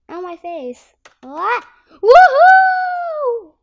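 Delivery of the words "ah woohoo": long high woohoo, drawn out slowly; excited cheer. Real, not acted. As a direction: cheer all the way down. Riding down the slide.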